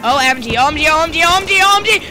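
high, loud panicked yelling. Real, not acted. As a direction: shout it in frantic alarm. Panic in game.

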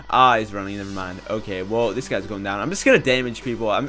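ordinary talk, nothing marked

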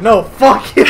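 loud imitation of a defiant character; laughing, shouted retort; mock defiant shout. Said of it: "he's like".

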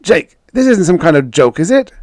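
very high, loud question; reporter calling out. A narrator's reading. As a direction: shout it from a press pack. Reporters call questions to Jake.

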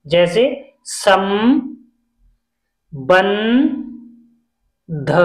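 संबंध drawn out slowly, syllable by syllable, सम्-बंध; teacher spelling out संबंध syllable by syllable; slow syllable demo. Shown as a demonstration. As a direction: stretch संबंध slowly to show the nasal m. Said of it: "अंक की मात्रा से हम हमेशा दो उच्चारण".

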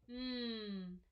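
"Mm" as a held hum; isolated m sound. Shown as a demonstration. Teacher finding the first letter, held "Mm". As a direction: hum "Mm" with no vowel after it. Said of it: "the letter that makes the sound".

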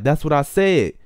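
loud imitated rude comeback; disrespectful retort. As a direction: throw it back rudely, mimicking him. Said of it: "sounded like he was getting disrespectful".

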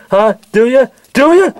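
high, escalating taunt; taunting challenge. Acted. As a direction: dare them, pitch climbing each time. Daring the other to grab it.

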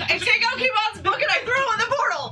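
high-pitched, excited rush of action; frantic declaration. Acted. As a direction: blurt out your move urgently.

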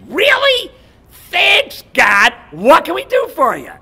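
high, loud acted amazement; hammed-up delighted gratitude; grateful crowd. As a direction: gush thanks at the top of your voice. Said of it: "And they said".

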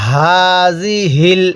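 হাযিহিল with a light voiced throat h, drawn slow; throaty ha. Shown as a demonstration. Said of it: "এরকমভাবে পড়ব".

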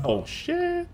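high squeaked "shit"; startled. Real, not acted. Streamer gets detected by the game, startled squeak. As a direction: squeak in sudden alarm.

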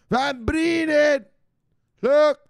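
loud, high drawn-out yell; drawn-out rallying shout. Acted. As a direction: yell the name like a battle cry. Shouted rallying name call.

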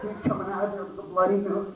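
ordinary delivery

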